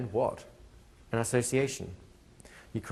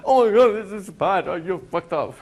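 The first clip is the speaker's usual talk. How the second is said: much higher, loud, frantic mock alarm; hammed-up panic; 9 semitones higher, 9 dB louder, slower than usual. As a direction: play a hypnotist losing it in front of the client. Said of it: "If you start freaking out".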